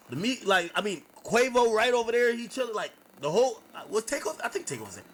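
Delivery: normal talking voice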